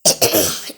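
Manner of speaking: loud dry cough; a sharp cough from a sore throat. Real, not acted. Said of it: "Eu tô quase sem voz". (tosse seca)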